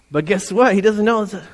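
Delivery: usual speaking voice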